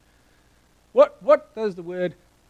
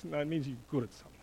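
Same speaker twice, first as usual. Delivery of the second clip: ordinary talk, nothing marked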